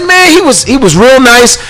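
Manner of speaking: high emphatic pleading; emphatic retelling. Retold or imitated. Host defending the fan on air, high and emphatic. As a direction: insist the fan was nice. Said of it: "I said".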